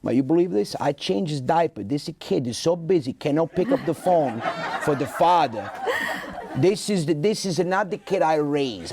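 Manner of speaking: put-on Italian-accented father complaining off the phone; old Italian father ranting to himself; father complaining about his son, Italian accent; grumbling Italian dad. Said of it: "with that accent".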